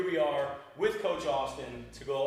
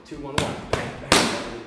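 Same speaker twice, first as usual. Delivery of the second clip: calling combos; 4 dB louder than usual. Shown as a demonstration. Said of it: "any cues that I have, you guys are gonna hear".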